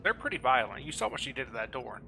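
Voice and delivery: ordinary delivery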